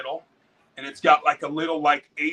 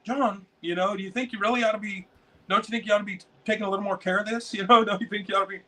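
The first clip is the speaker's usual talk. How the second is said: quick, a touch higher mom nagging; imitating mom; 6 semitones higher, faster than usual. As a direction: nag him lightly like mom. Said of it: "Mom always kinda got on my ass".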